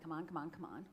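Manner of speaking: lightly voiced dad, low and muted; impatient dad. Dad again hurrying his family, mildly impatient. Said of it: "My dad, again, is like".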